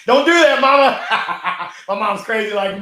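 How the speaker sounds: high, loud laughing plea to his mom; laughing protest. Real, not acted. Mom's comment touches him.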